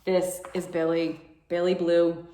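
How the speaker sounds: plain reading voice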